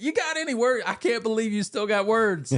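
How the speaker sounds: high mock disbelief; exasperated family. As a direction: play the fed-up family. Family mocking his chatter.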